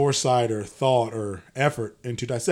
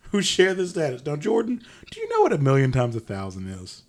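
usual speaking voice

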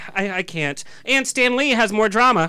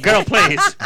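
sassy dismissal; 12 semitones higher, 5 dB louder than usual. Real, not acted. Host dismissing the age joke, laughing and sassy. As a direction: toss it off with sass.

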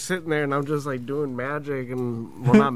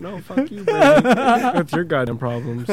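homegirl refusing; 5 semitones higher, 7 dB louder than usual. Retold or imitated. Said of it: "can you talk to her".

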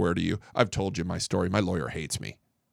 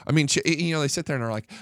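ordinary delivery

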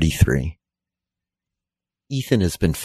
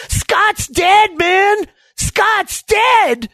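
very high-pitched urgent holler; alarmed shout; 4 dB louder, much slower than usual. Acted. Hurley racing down the beach, alarmed shout. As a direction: shout the bad news down the beach.

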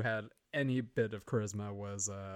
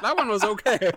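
put-on loud, high caricature Chinese accent; mock Chinese accent; 10 semitones higher, 13 dB louder than usual. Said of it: "That sounded like a Chinese person".

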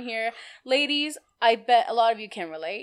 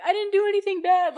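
put-on panicked kid; 9 semitones higher, 5 dB louder than usual. Scared kid calling mom.

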